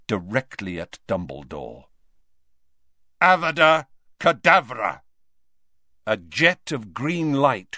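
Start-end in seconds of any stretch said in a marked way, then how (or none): 3.2-5.0 loud, higher curse; forceful incantation. A narrator's reading. Snape casts the killing curse at Dumbledore, forcefully. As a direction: hurl the spell out loudly.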